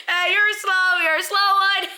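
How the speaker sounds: high, loud gleeful taunt; mock taunting. Teasing her over the hidden phone, mock taunting.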